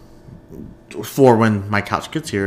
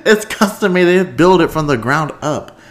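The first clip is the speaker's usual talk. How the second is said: laughing disbelief; 8 semitones higher, 7 dB louder than usual. Real, not acted. Laughing at her own mistake.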